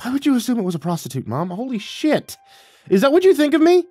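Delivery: high-pitched, aghast outburst; choked disbelief. Acted. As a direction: rise to a loud, offended squeak. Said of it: "slapped my free hand against the wall and choked".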